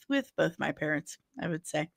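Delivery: ordinary talk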